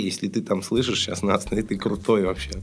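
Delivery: ordinary delivery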